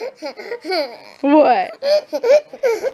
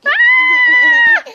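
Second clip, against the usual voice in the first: playful shriek; 9 dB louder than usual. Real, not acted.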